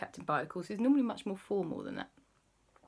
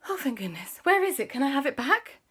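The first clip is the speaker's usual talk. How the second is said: high relieved pleading; relieved queen; 9 semitones higher, 3 dB louder, faster than usual. Acted. Queen relieved and asking for the ring, high. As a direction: beg for it back eagerly. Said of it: "the Queen said".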